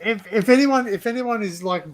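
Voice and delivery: normal talking voice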